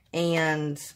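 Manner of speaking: usual speaking voice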